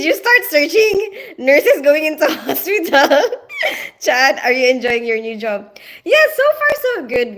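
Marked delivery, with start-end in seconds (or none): 6.0-7.4 higher, bright quick reply; chirpy answer. Real, not acted. Telling Chad the new job is going fine, cheerful high reply. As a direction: toss it off cheerfully.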